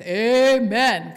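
a sudden loud amen from the pews; loud Baptist amen. Retold or imitated. Husband calling out during her sermon, a loud amen. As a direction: call out amen like a Baptist in the pew. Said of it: "'cause he was a Baptist".

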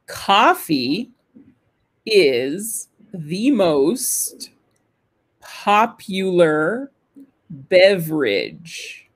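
word by word with long pauses; careful, drawn-out pronunciation demo in small chunks; slow model reading. Shown as a demonstration. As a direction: read slowly so learners can shadow. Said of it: "Could you please do it again?".

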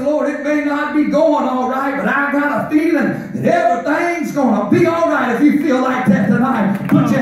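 shouted, high preaching; preaching at full force. Acted. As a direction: drive the promise home to the church.

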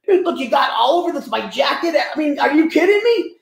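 whiny-high mock outrage; belittling indignation. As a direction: act out an indignant complainer, pitch jumping up. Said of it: "he's, like, just belittling her".

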